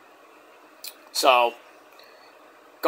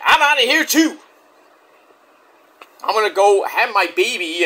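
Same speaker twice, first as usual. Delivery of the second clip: quitting too; 4 semitones higher than usual. Retold or imitated. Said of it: "she's like".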